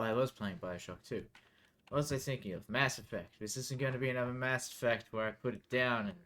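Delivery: usual speaking voice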